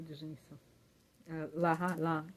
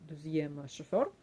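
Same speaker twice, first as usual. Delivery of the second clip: normal talking voice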